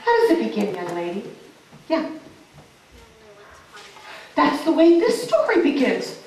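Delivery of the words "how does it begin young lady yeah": ordinary delivery, nothing marked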